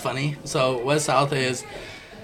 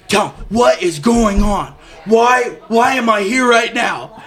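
loud, high, drawn-out mock demand; retold exasperated dad; 7 semitones higher, 7 dB louder, slower than usual. Dad summoned to the office, loud exasperated retelling. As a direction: hammer each word slowly as an exasperated dad.

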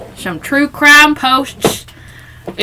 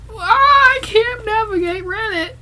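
laughing frustration; 11 semitones higher than usual. Real, not acted.

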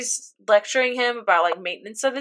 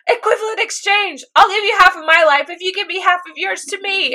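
retold yelling; 8 semitones higher than usual. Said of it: "starts screaming at her".

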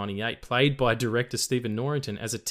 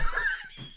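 burst of laughter; 3 dB quieter than usual. Real, not acted. Laughs at own impression.

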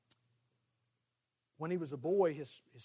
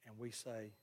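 usual speaking voice

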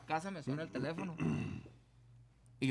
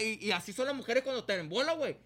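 ordinary talk

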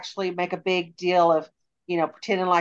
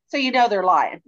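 ordinary talk, nothing marked

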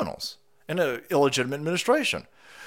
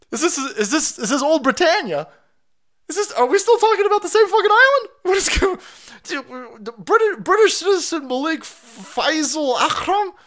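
higher and louder, still disbelieving; incredulous; 12 semitones higher, 4 dB louder than usual. Real, not acted. Questioning viewers about the British suspect, incredulous. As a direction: keep the shocked questioning going.

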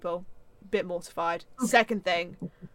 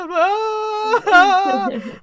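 loud, high sobbing laugh; half-sobbing burst of laughter; crying laugh; 13 semitones higher, 11 dB louder than usual. Real, not acted. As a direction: laugh through the tears. Tearful laugh after the quote.

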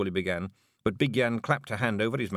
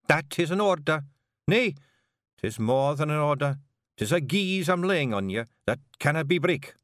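raised, firm Scots order; Kelder's command; 9 semitones higher, 3 dB louder than usual. Acted. Jeanie commands Rob as Kelder, in a firm raised Scots voice. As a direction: lay down the law.

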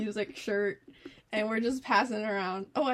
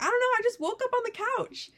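put-on high-pitched clueless drunk voice; mock-drunk insistence, pitched up; imitating drunk friend; 12 semitones higher, 3 dB louder than usual. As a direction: protest in a high, befuddled voice. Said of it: "she wasn't sober".

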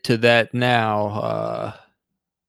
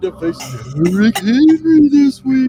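spooky voice; 12 semitones higher, 5 dB louder than usual. Pretend. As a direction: read it like a taunting ghost. Reads submission in spooky voice.

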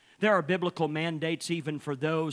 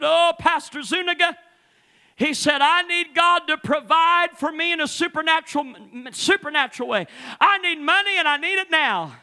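hammed-up desperate plea, pitched higher; mock pleading man; 11 semitones higher, 8 dB louder than usual. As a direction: beg the pastor for money, half-mocking. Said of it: "he was up there bawling and a squalling".

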